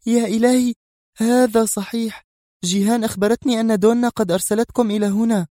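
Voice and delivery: enthusiastic. A narrator's reading. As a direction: burst out with excitement. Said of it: "ثم استطردت في حماس".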